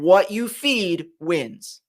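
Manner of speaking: very slow, every word weighted; slow deliberate preacher's emphasis. Shown as a demonstration. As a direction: spell out the moral word by word. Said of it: "repeating the moral slowly".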